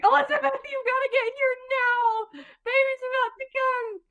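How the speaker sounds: imitated urgent call. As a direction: call her in before the baby comes. Mom calling friend in urgently.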